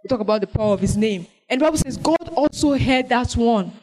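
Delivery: normal talking voice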